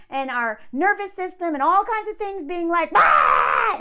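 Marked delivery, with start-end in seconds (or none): 2.9-3.8 short low loud panicked yell; mock panicked yell. Acting out panicking trauma responses, mock panicked yell. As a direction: yelp like alarmed inner voices.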